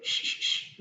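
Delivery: soft unvoiced shush to silence the room; shushing whisper. A narrator's reading. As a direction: whisper shh, warning everyone. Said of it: "होठों पर उंगली रखकर सब लोगों को सावधान करते". (फुसफुसाकर श श करना)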